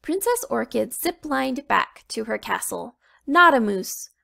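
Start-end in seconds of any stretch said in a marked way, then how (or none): 3.3-4.1 calling out. Acted. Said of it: "called out to her reindeer".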